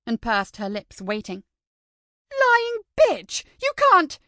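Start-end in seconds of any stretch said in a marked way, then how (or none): 2.3-4.2 much higher and louder furious yell, cut off; furious outburst. Acted. Pupil screaming at the lying teacher, furious. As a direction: scream the accusation back at her. Maddie erupts at false accusation.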